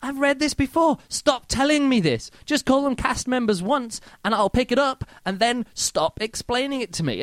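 exasperated reader. Retold or imitated. Reader complaining to the author, exasperated whine. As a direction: plead with the author to stop repeating himself. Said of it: "And I'm like".

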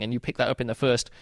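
ordinary delivery

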